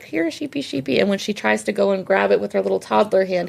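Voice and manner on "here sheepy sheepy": higher, softer coaxing call; a hint of calling a pet sheep. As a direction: lightly mimic coaxing an animal. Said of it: "he calls her up".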